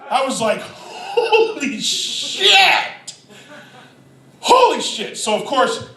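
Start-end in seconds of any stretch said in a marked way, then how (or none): 0.6-2.5 high, very drawn-out exclamation; stunned amazement. Retold or imitated. Stunned reaction to the dive. 4.4-5.2 high, brief exclamation at normal volume; short astonished exclamation. Retold or imitated. Same exclamation repeated, quicker.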